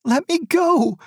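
mother begs husband to release her, desperate; desperate plea. A narrator's reading. Mother pleads to pass.

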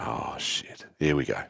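whispered dread. Retold or imitated. Boy sent to his room awaiting dad, low whispered dread. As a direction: hush it out, knowing dad is coming.

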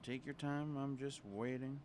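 hushed, patient murmur; quiet. Real, not acted. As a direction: say it under your breath. Waiting while partner texts.

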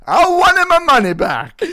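high, loud, slow hammed-up Italian wail; Italian accent. Repeating the angry fan's demand in a comic Italian accent. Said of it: "I need to read this in an Italian accent".

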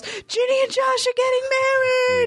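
high-pitched, drawn-out shriek of joy; excited fan squeal. Acted. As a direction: squeal it like a fangirl reading big news. Acting out excited fan's email.

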